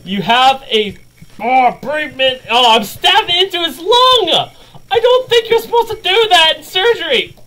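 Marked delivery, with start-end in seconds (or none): 2.5-7.3 high-pitched panicked exclamation; alarmed outcry. Real, not acted. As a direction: voice shoots up in amused horror. Horrified at own game blunder.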